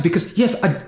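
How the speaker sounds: ordinary talk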